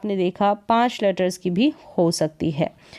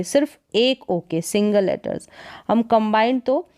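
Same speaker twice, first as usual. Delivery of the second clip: normal talking voice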